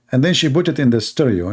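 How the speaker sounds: ordinary talk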